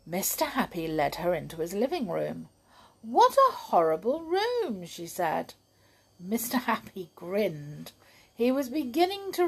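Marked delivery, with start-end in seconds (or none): 3.0-4.8 high, pleased voice; contrary girl. Acted. Miss Contrary judges Happy's room, high and cheerful.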